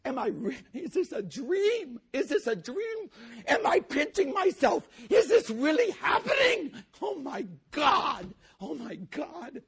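high, rising, breathless wonder; as if pinching yourself awake; stunned disbelief. Acted. Preacher voicing Peter's disbelief watching Lazarus rise. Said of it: "he starts biting like".

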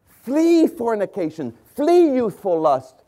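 slow fervent preacher's cry; preaching exhortation. Acted. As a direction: thunder the warning slowly.

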